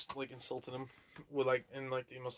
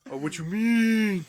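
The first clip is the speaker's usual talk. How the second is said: imitating Severin; 7 semitones higher, 4 dB louder, much slower than usual. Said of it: "Or his laugh".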